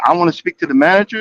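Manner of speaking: usual speaking voice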